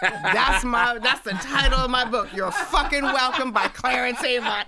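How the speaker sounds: laughing through the words; can barely get it out for laughing; real laughter at her father's joke. Real, not acted. Laughing at dad's title.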